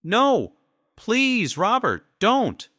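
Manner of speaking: very high, loud terrified scream; wife screaming as gun comes out; screaming plea. A narrator's reading. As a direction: scream at him to stop. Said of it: "Judy screamed".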